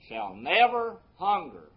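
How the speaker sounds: slow phrase with a strong peak on "never"; stress on NEVER. Shown as a demonstration. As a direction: lean hard on "never". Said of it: "I want to emphasize that word never".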